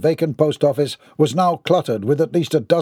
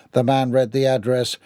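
plain reading voice

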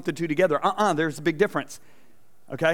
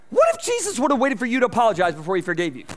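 usual speaking voice

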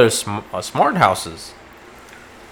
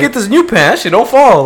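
high, loud cheesy sales voice; high hammed-up pitchman; mock infomercial pitch; 12 semitones higher, 6 dB louder than usual. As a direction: sell the pan like a cheesy TV ad.

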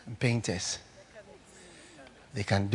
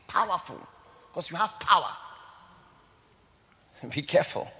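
ordinary delivery

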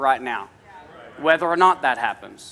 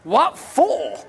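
mock disbelief; 7 semitones higher than usual. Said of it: "I'm like".

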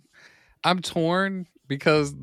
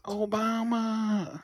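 drawn-out, higher, softened name call; playful soft calling; 5 semitones higher, 5 dB quieter, much slower than usual. Pretend. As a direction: stretch the name out softly, as if summoning him. Playfully calling to Obama for a record.

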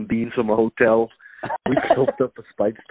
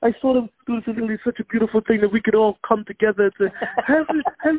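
imitating Trevor Noah; 11 semitones higher, faster than usual. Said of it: "He says, he says".

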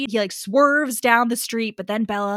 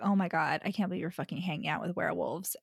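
hushed mock scolding; 3 semitones lower, 8 dB quieter, faster than usual.

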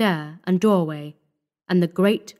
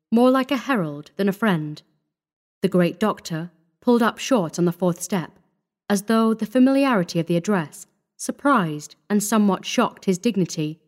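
ordinary delivery

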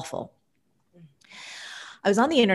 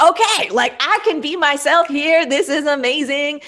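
thrilled; 9 semitones higher, 7 dB louder than usual. Retold or imitated. Thrilled at new female workplace.

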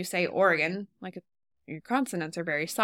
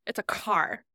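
ordinary talk

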